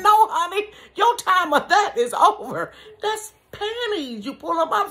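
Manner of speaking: high and loud, firm explaining; exasperated correcting. Retold or imitated. Mom telling granny the Depend is panties, loud and high. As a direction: set her straight, loudly.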